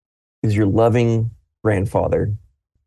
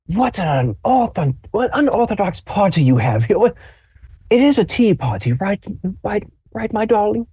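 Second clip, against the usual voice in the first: put-on old grandfather; 9 semitones higher than usual. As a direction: muse aloud like a confused grandpa. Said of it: "he just goes".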